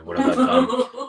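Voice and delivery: high laughing what have I; laughing panic. Retold or imitated. Retelling his own panic.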